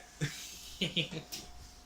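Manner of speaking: high, voiceless wheeze of laughter; wheezy laugh. Real, not acted. Streamer laughing at the repeated verdict, wheezy laugh. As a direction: laugh yourself out of breath.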